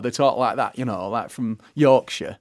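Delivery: broad northern English accent; broad Yorkshire. Shown as a demonstration. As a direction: talk broad, like someone from Yorkshire. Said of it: "it's very broad".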